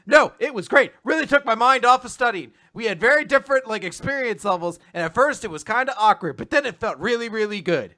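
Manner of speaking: high, loud, eager retelling; acted-out breathless enthusiasm; gushing explanation. Acting out her gushing reply.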